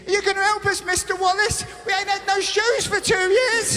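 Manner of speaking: hammed-up pleading kids; mock kids. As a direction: beg like a neglected child.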